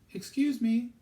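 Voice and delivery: put-on high, loud call of a young girl; loud girl's call. Girl calls to old gardener over the wall, high and loud. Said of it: "she said loudly".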